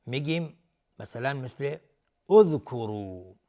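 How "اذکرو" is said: "اذکرو" slow and loud, as udhkuru; starting with damma. Shown as a demonstration. Teacher gives an example, "اذکرو" slowly as udhkuru. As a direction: open "اذکرو" on a rounded u, drawn out. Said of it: "اگر حرف سوم ضمه باشه".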